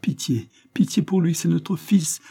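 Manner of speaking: high, tearful plea; pleading sob. A narrator's reading. Mother begging mercy for their son, tearful and high. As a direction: beg through tears. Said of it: "sanglota madame Hare".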